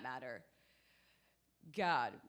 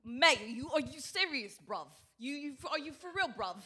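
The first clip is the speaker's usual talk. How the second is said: put-on South London accent, incredulous; 4 semitones higher than usual. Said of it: "all of South London was like".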